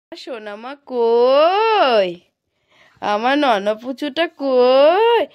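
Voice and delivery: slow, loud drawn-out calling. Real, not acted. Mother calling out for her little daughter. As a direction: call the little one slowly and lovingly.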